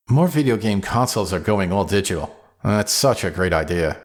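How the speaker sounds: sarcastic. Shown as a demonstration. Presenter demonstrates the same line with sarcasm, sarcastic. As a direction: say it as if you mean the opposite. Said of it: "now I'll read it with sarcasm".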